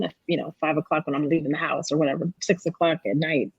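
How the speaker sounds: usual speaking voice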